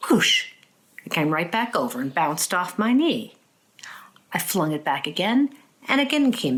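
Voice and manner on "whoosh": mock whoosh. Throwing the ball over fence.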